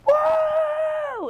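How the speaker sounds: very high loud whoop; imitating dad's cheer. Her dad in the audience, an imitated high whoop. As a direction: whoop like a proud hockey dad. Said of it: "he was like".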